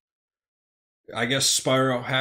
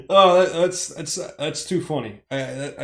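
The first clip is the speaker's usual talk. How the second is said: normal talking voice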